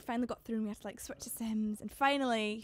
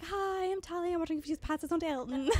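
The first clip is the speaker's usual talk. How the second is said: nervous rush; 6 semitones higher, faster than usual. Retold or imitated. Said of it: "I'm like a nervous wreck".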